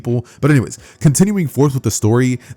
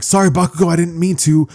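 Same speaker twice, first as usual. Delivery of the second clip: higher-pitched apology; apologetic Light Deku; 6 semitones higher than usual. Acted. Deku apologising to his rival Bakugo, sheepish. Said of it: "with him apologizing".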